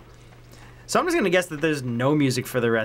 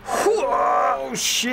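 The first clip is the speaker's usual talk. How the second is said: startled yelp; 9 semitones higher than usual. Real, not acted. Player caught off guard by the game, startled outburst. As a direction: yelp it out in sudden shock.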